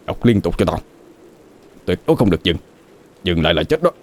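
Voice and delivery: hushed, urgent orders from a rattled uncle; tense, subdued panic; flustered. A narrator's reading. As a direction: press the young one under your breath. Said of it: "Chú Hùng cũng rối bời theo".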